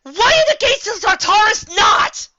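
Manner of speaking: high, loud, slow anguished cry; pony crying out in panic, shouting; panicked shout. Acted. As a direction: scream it to the heavens.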